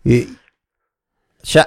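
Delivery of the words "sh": ordinary talk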